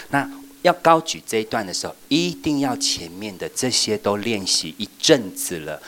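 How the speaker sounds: ordinary delivery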